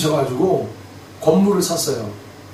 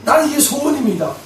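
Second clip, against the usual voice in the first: high, loud, earnest plea to God; a woman begging God for a son, high and loud; Hannah praying; 9 semitones higher, 4 dB louder than usual. Retold or imitated. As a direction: Hannah pouring out her wish, voice raised high. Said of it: "한나에게".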